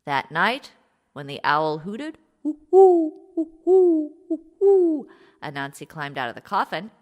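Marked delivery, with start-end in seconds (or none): 2.4-5.1 high hooting owl call. A narrator's reading. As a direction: hoot like an owl at night. Owl hoot sound imitated.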